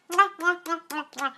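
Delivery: high "mwah" kiss noises; blown kisses. Real, not acted. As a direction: blow a string of farewell kisses. Blowing kisses signing off.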